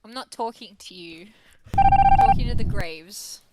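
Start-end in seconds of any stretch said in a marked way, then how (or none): 1.8-3.4 high, loud, slow theatrical call; dramatic shout. Pretend. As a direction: proclaim it to the graves. Mock dramatic declaration.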